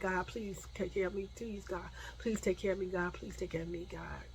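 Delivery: very soft, rapid-fire repeated plea to God; hushed prayer. Retold or imitated. Woman walking alone in the dark prays to God, hushed. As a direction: murmur a private prayer under your breath.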